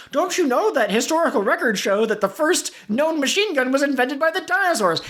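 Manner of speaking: high, nerdy eager voice; Pete character voice. Acted. Pete lecturing absurdly.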